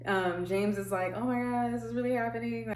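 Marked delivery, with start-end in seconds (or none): 1.1-2.7 mock excited husband. James reacting, quoted.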